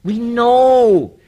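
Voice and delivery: KNOW stretched high and loud; "know" held out loud and high; emphatic concession. Retold or imitated. Official stressing what is understood.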